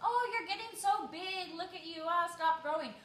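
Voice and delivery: put-on high, fast cooing over a kid; mock gush like a doting relative. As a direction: imitate an adult marvelling at a child. Gushing relatives greet growing child.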